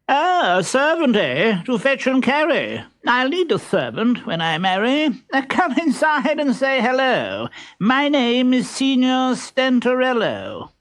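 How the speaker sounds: very high, pompous suitor voice; silly pompous voice. Acted. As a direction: play a vain fool, high and squeaky. Stentorello introduces himself.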